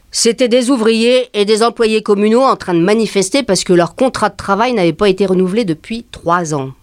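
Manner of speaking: normal talking voice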